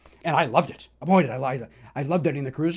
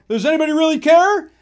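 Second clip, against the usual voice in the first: fan calling out lyric; 11 semitones higher, 8 dB louder than usual. Retold or imitated. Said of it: "somebody else said".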